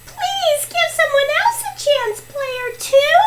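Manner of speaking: high-voiced plea; pleading. Player asking player two for a chance, pleading.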